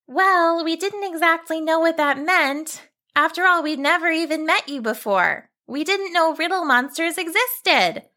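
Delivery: put-on high earnest girl; girl explaining herself; young girl voice.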